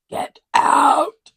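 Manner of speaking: high, loud shriek of a command; a sudden piercing cry to get out; horror-movie scream. Retold or imitated. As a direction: scream it like the haunted house's voice. Quoting the Amityville Horror movie.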